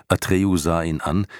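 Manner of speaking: usual speaking voice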